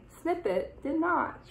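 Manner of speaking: usual speaking voice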